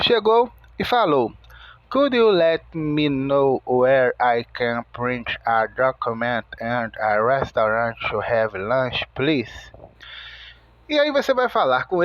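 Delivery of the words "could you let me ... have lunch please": low, even, run-on English question; hotel guest asking straight through without breaks; American guest's running request. Acted. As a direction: recite it in one unbroken stream, on a low monotone. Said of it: "se ele falar as frases muito rapidamente".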